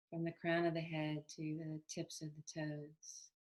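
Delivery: calm, unhurried meditation guidance with long pauses; even, settled meditation voice; guided meditation. Acted. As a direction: gently lead them into body awareness, leaving space between phrases.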